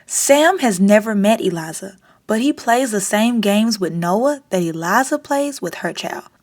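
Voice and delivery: ordinary delivery, nothing marked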